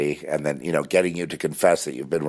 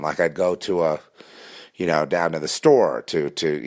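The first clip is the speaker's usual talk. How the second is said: usual speaking voice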